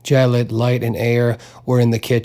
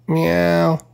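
high mewing cry; lightly voiced cat cry; 6 semitones higher than usual. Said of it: "the cat cried".

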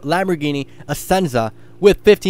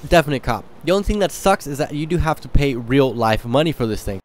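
normal talking voice

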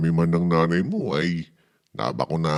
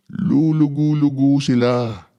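sleepy drag; 9 semitones higher, 5 dB louder than usual. Acted. Father mimics sleepy soldiers.

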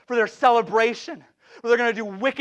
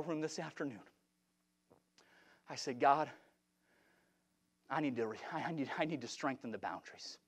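low, very soft confessional delivery with long pauses; hushed preaching; 6 semitones lower, 10 dB quieter than usual. Real, not acted. Preacher confesses his hotel-room prayer, hushed and low. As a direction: drop to a quiet, halting testimony.

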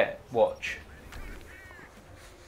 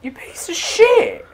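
high, slow, furious yell at the board; angry yell; 12 semitones higher, slower than usual. Real, not acted. Player cursing his beaten army, furious high yell. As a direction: curse the enemy army, pitch way up. Losing a battle in Risk.

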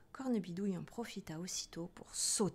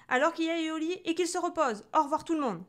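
higher, loud dismissal; loud, impatient goodbye; irritated doctor; 8 semitones higher, 7 dB louder than usual. A narrator's reading. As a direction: fire off the order and leave in a huff. Annoyed doctor storms out.

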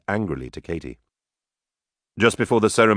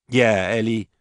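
higher-pitched mocking agreement; sarcastic; 4 semitones higher, slower than usual. A narrator's reading. Drunken sister echoes mother's warning to the bride, sarcastic. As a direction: chime in with mock concern. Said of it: "Katie said sarcastically".

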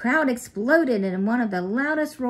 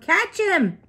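loud, high shout; dog yelling to start the run, loud shout; 11 semitones higher, 8 dB louder than usual. A narrator's reading. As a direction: yell it to set off the steal. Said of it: "Harry yelled".

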